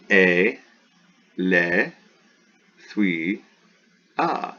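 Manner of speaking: "E, le, thui, a" each alone with long gaps; syllable by syllable. Shown as a demonstration. Teacher divides a Greek word for students, spaced syllables. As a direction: sound out one syllable at a time.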